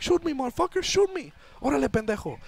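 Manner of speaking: very high shouted dare; defiant taunt. Retold or imitated. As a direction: taunt the gunman to shoot. Driver taunts gunman.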